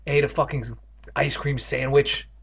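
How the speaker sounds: ordinary delivery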